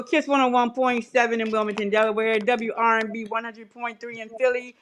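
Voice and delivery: usual speaking voice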